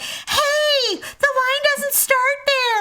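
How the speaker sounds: high, loud call from the crowd; mock crowd yelling. Annoyed park guests yelling at a line-cutter, mock shout. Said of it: "all these people are like".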